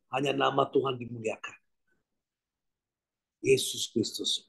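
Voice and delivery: ordinary talk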